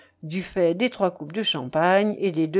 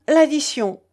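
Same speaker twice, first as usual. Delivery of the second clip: higher, louder grating demand; 6 semitones higher, 5 dB louder than usual. A narrator's reading. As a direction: call for the bill in a grating voice. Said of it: "d'une voix grinçante".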